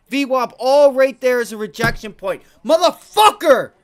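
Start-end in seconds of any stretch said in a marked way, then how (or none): 2.6-3.7 long, loud, high slow scream; furious scream. Real, not acted. Trader screaming at the chart, raw fury. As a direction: scream out the rage.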